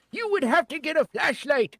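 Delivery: usual speaking voice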